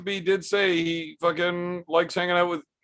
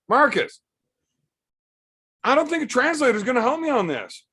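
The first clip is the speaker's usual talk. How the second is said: exasperated; 9 semitones higher, faster than usual. Real, not acted. Exasperated at a confusing chat message.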